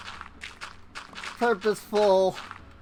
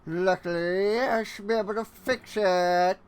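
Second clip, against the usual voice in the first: a hint of a smug cartoon villain musing; Fat Cat villain voice. Gamer musing at his build in a mild cartoon villain voice. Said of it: "old cartoon villain voice".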